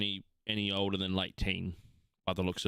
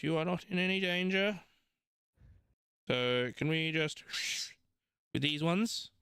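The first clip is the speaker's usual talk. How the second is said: much higher, impatient clerk voice; curt halfling scribe; 8 semitones higher than usual. Acted. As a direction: brush them off, busy and impatient.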